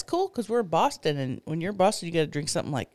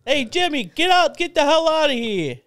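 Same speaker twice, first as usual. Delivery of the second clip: high, loud hammed-up Boston yell; attempted Boston accent; 7 semitones higher, 4 dB louder than usual. Soccer dad yelling at Jimmy, attempted Boston accent. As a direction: holler it in a badly faked Boston accent. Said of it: "That's not the right accent, but".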